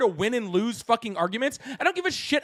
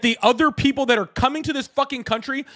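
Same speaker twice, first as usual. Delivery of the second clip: loud, higher, furious cut-in; angry outburst; 4 semitones higher, 8 dB louder than usual. Real, not acted. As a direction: explode at him mid-argument. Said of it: "you're getting mad".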